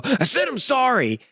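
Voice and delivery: high, drawn-out whiny kid imitation; kid's grudging apology. Parent imitating kids' fake apology, high drawn-out mock yell. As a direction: mimic a sulky kid forced to apologize.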